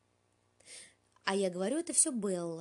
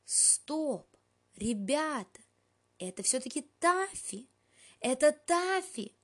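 excited shouting; 7 semitones higher, 5 dB louder, slower than usual. A narrator's reading. Cats recognise Taffy, shouting.

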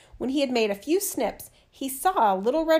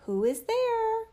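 put-on old grandmother voice; 11 semitones higher, slower than usual. A narrator's reading. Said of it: "said the grandmother".

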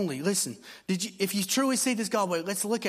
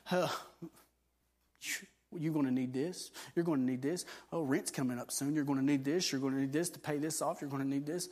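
soft, sly, lower coaxing voice; tempting devil; 3 semitones lower, 5 dB quieter than usual. Retold or imitated. Devil tempting a believer about rent money, softly sly. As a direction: whisper worry into their ear. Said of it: "the devil comes at you again".

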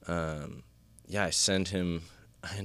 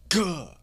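high sharp yelp of pain; pained cry; 11 semitones higher, 5 dB louder than usual. Retold or imitated. Man kicked in the face in a car trunk, sharp yelp. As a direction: yelp as if a boot just hit your face. Boot stomps on his face.

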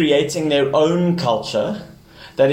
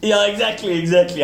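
chuckling yes, exactly, a touch higher; laughing agreement; 7 semitones higher than usual. Real, not acted. Laughing with the host over the coach's request.

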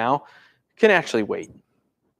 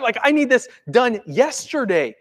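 high-pitched urgent demand; freaking out; 7 semitones higher than usual. Acted. Desperate believer demanding action, freaking out. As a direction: push it with impatient urgency. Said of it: "I'm freaking out on the inside".

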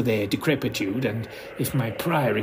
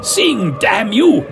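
loud, higher barked command; angry order; 7 semitones higher, 6 dB louder, slower than usual. Acted. Narrator ordering the naked acolytes, angry loud command. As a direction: force the cowering acolytes to sing. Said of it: "I ordered Northfield's acolytes".

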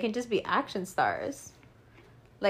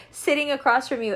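high laugh breaking into the words; 5 semitones higher, 3 dB louder than usual. Real, not acted. Describing acting across from a hated costar, laughing through it.

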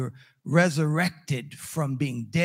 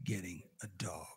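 low, measured, final no; imitating a wife's quiet, deliberate veto; firm refusal; 7 semitones lower, 4 dB quieter than usual. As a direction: lay down the law softly, word by word. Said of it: "she looked at me and she said".

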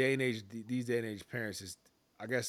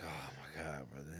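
exasperated groan; 8 semitones lower, 11 dB quieter than usual. Retold or imitated. Exasperated parent reaction.

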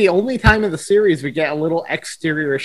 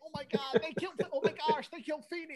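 much higher, softer, fast panicked squeak; mock panic; 12 semitones higher, 16 dB quieter, faster than usual. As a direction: squeal it like a frightened kid. Quoting Eric's panicked line.

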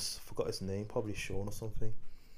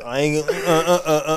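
laughing; 6 semitones higher, 11 dB louder than usual. Real, not acted. Laughing while stammering.

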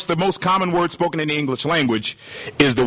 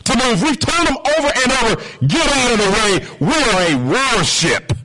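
higher-pitched urgent report to a captain; alarmed report; 6 semitones higher than usual. Acted. Sailor reporting a stubborn ship to his captain, urgent. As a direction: report the problem to your superior, pitched up. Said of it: "He says".

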